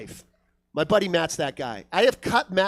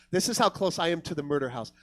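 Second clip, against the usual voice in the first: normal talking voice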